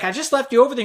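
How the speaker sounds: high-pitched mimicked mother; defensive mom. Mom explains to angry son, high defensive voice. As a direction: protest lamely in a mom voice.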